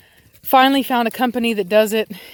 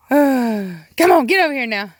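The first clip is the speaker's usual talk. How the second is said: said like I mean it; 4 semitones higher, 4 dB louder than usual. Real, not acted. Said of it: "say it like you mean it".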